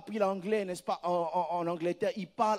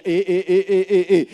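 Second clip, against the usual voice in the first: loud stammer; 15 dB louder than usual. Acted. Stammering at sermon peak.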